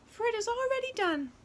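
high, quick wren voice; a tiny bird granting the wish; little bird voice. Acted.

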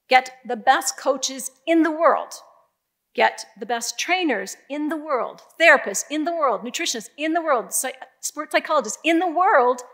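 insistent list, "in the world" falling firmly each time; emphatic repetition. Retold or imitated. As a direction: drive home every "in the world". Retold pitch, rhythmic emphatic list.

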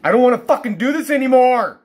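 loud, high, despairing yell; real end-of-rope shouting; desperate outburst. Real, not acted. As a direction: yell it out, utterly fed up. Fed up with living in pain.

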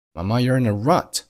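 ordinary delivery

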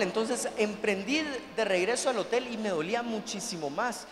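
usual speaking voice

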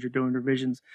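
fading repeat. Shown as a demonstration. Host repeating a principle to guest, fading softly. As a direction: let the last "you're doing revisions" fade away softly.

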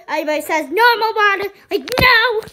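loud, fast mock outrage; indignant. Real, not acted. Kid defending flavored water, loud indignant scoff.

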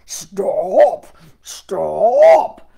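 loud bear shout. Acted. The bear bellowing at the runaway gingerbread boy. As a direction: bellow stop like a hungry bear. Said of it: "And so the bear cried".

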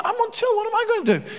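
helpless high-pitched plea; mock panic; panicked protest. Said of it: "So he's like".

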